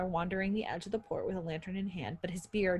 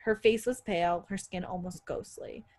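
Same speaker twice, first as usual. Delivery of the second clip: plain reading voice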